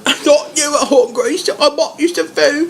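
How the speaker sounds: high sweet offering; sweet Ollie. Retold or imitated. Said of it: "Ollie's like".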